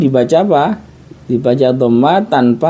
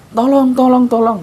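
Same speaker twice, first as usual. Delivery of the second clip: high urgent calls of tolong; mock cry for help, much higher; 10 semitones higher than usual. Shown as a demonstration. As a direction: plead for help from anyone, high. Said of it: "ya kan".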